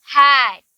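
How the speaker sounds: हैट drawn out with a long vowel and falling tone; pronunciation demo. Shown as a demonstration. Teacher shows the class how to say हैट, pronunciation demo. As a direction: stretch the vowel of हैट, clearly. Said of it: "इसे हम कैसे pronounce करेंगे?".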